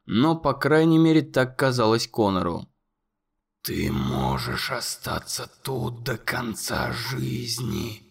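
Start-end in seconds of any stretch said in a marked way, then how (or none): 3.6-8.0 very low, slow, whispered monster voice. A narrator's reading. Monster whispering accusations to Conor, low and slow. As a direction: loom over the boy and breathe every word.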